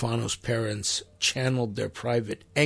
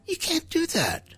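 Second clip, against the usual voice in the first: very high, incredulous objection; squeaky-high disbelief; high-pitched protest; 3 dB quieter than usual. Real, not acted. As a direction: shoot the voice way up in protest. Preacher protests empty condolences.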